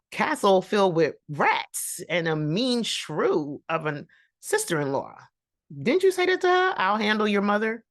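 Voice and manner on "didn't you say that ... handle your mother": high, loud disbelieving challenge; incredulous callout. Real, not acted. Vlogger challenging George on his promise, high and incredulous. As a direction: throw his words back at him in disbelief.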